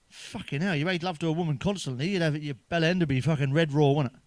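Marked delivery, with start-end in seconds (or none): none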